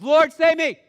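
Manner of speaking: loud, high cry for help; desperate cry. Acted. Peter sinking, crying out to Jesus, a loud desperate shout. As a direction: shout it out as if going under.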